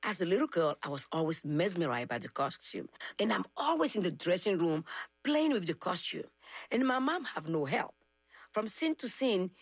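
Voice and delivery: usual speaking voice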